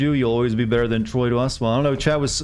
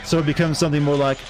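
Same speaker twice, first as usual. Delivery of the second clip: ordinary talk, nothing marked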